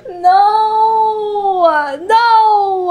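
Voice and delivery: high, loud wailing no; sobbing cries. Real, not acted. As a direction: cry it out in real grief. Sobbing as companion robot dies.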